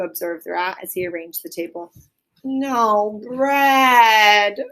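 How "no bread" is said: groan. A narrator's reading. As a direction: groan out the disappointment. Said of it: "groaned the mole".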